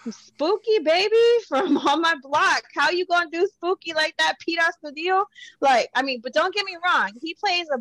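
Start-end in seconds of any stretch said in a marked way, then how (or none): 0.1-5.2 high, gushing fan outrage; excited fangirl. Real, not acted. Fan complaining to a friend about the actor, high and gushing.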